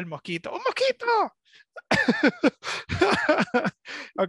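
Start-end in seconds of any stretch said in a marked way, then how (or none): 0.5-1.1 high-pitched surprised cry; sudden surprised shout; surprised exclaim. Real, not acted. Spots a mosquito. 1.8-4.1 laughter. Real, not acted. Laughing after the mosquito.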